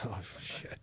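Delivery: barely voiced, very soft; dismayed aside. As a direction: let it out under your breath as retold dread. Nervous rookie's dread, retold.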